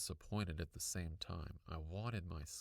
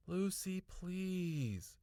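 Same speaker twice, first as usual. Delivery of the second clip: impatiently; 11 semitones higher, much slower than usual. A narrator's reading. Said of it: "Mom started impatiently".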